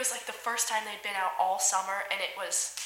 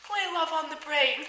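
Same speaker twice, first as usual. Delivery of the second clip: higher-pitched, unhurried request; imitated song request; 7 semitones higher than usual. Staff member asking driver for favorite song, high-voiced request. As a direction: ask for it with pitch raised and falling at the end.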